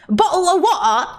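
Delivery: high, punchy mock British accent. Streamer joking with British raider's fans, mock British accent. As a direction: playfully exaggerate a British accent. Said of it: "put on a British accent".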